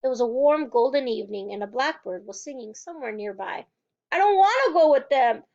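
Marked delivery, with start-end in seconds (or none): 4.1-5.4 louder, higher outburst; defiant refusal; sudden shout. A narrator's reading. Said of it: "Matilda shouted suddenly".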